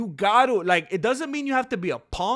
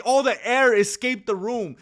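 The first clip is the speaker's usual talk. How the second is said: ordinary talk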